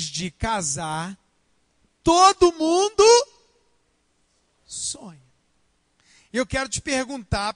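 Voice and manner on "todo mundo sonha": "todo mundo" shouted, slow and higher; preaching shout. Real, not acted. Pastor shouts to the whole congregation before quieting. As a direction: belt it to the back row.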